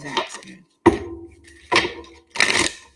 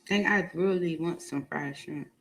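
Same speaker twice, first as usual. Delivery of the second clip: ordinary talk, nothing marked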